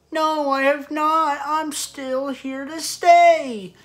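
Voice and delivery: high, even-pitched cartoon slacker voice; Shaggy. YouTuber showing a second kept impression, high cartoon voice. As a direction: hold it high and drawn along one note. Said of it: "And then there's Shaggy".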